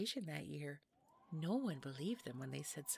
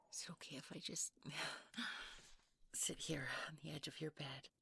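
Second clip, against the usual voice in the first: soft whisper broken by a giggle; coy whisper; 5 dB quieter than usual. Acted. Mrs Claus asking the child to sit on the bed, whispering. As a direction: ask sweetly, a little too sweetly.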